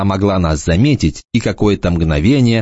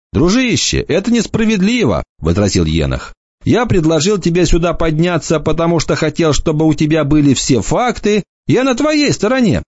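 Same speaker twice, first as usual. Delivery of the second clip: a hint of a teasing young lad, pitched up; light mocking boy's voice; lightly voiced cocky boy; 9 semitones higher than usual. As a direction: toss it off like a smug teenager. Enoch's voice.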